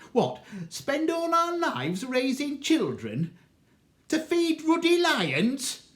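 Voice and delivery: high, loud furious tirade, slowing on ruddy lions; blazing mother. Acted. Mother rages at the magistrate, high loud fury. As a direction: rage at the magistrate in a put-on voice. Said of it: "mother got proper blazing".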